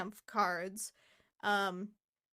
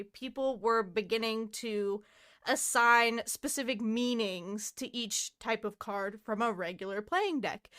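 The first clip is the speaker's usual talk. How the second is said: ordinary delivery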